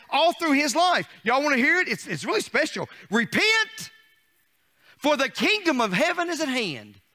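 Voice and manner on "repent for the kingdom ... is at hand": high ringing prophet's call of repent; John the Baptist's cry. Retold or imitated. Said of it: "John had one message".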